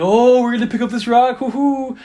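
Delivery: hammed-up spooky hoo-hoo; joking spooky tease. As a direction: tease like kids daring ghosts. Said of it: "as a joke, we're like".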